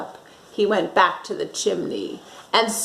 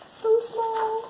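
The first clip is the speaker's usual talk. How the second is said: very high, soft squeak; a high, hushed little squeak for something tiny; 13 semitones higher, 5 dB quieter than usual. Acted. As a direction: say it as small as the crumb. Reader marvels at tiny crumb.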